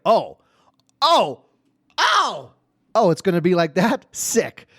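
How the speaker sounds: escalating loud, high "oh"s; acted amazement, rising into impressed excitement; delighted surprise. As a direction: react high and loud, thrilled by her nerve. Retold delighted surprise at daughter's answer.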